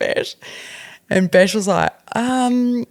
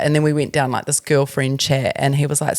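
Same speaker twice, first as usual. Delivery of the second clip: normal talking voice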